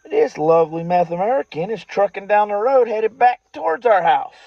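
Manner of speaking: usual speaking voice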